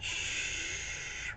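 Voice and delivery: whispered long shh like steam; coffee machine hiss. Shown as a demonstration. Imitating the coffee machine for viewers, whispered hiss. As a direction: hiss out the sound of brewing coffee. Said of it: "That's coffee being made".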